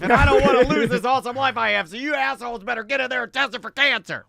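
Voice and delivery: loud, high ranting boss; angry boss. Pretend. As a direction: bark orders like a rich boss. Voicing the Doritos boss.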